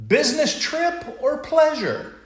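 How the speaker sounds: nervous small talk. Retold or imitated. Nervous man opens conversation.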